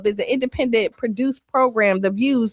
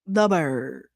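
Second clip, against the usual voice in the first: deep voice; 9 semitones lower, 5 dB quieter than usual. Acted. Both say station name deep.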